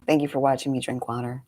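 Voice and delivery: ordinary talk, nothing marked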